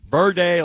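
"Verde" said as VUR-day; Camp Verde pronunciation. Shown as a demonstration. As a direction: end "Verde" on a long AY. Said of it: "like Camp Verde".

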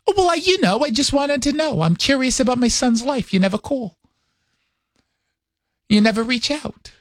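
high, aggrieved New York mom impression; mother's New York accent. Mother reproaches son for never calling, high New York accent. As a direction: imitate a guilt-tripping New York mother. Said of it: "talks like this all the time".

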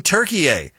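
high "Turkey, eh" run together as three syllables; mocked native-style pronunciation. Host disputing the new name, high "Turkey, eh" with extra final syllable. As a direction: overdo "Turkey, eh" with a tacked-on "eh". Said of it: "nobody from Turkey is saying".

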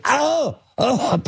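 high-pitched "oh" of pain; mock pained yelp. Retelling being bitten in the arm, mock pained yelp.

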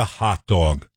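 hot dog with New York vowels, "dawg"; New York accent. Shown as a demonstration. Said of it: "I still slip into a New York accent".

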